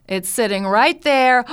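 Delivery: ordinary talk, nothing marked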